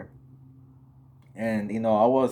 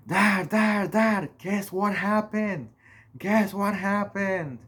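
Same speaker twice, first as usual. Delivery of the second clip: frantic; 9 semitones higher, slower than usual. Retold or imitated. Running to wake his dad.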